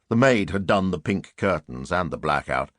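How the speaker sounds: ordinary delivery, nothing marked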